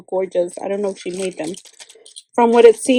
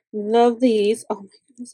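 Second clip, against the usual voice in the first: normal talking voice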